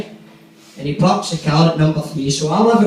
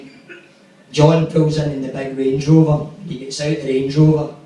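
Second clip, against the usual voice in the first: ordinary talk, nothing marked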